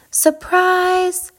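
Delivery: high, sustained "surprise!"; joyful cry. A narrator's reading. Animals greeting hedgehog round the corner, high cry of surprise. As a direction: sing it out high, short of shouting. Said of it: "came the cry".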